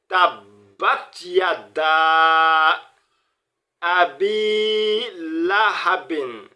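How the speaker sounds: slow, syllable by syllable; slow recitation. Shown as a demonstration. Teacher rereading the verse slowly for students. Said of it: "je reprends tout".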